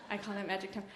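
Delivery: hushed afterthought; soft repeat. Shown as a demonstration. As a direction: drop the volume on I call that magic time.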